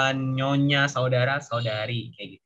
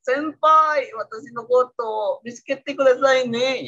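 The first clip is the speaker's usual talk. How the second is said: high-pitched anime voice, loud on "Senpai"; anime character; 7 semitones higher, 3 dB louder, slower than usual. Acted. Teacher imitates anime heard by learners, high anime voice. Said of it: "kalau nonton, nonton di anime".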